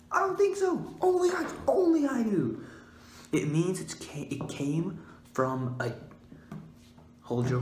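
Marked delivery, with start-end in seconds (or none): 0.1-2.6 high squeaky smug voice; smug tease. Acted. To viewers, boasting that only he knows, squeaky smug voice. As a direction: squeak it out like a know-it-all cartoon.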